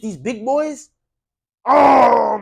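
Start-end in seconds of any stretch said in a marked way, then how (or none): 1.6-2.4 a loud, high, exasperated oh. Real, not acted. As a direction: let the frustration burst out. Exasperated outburst about lesser sides.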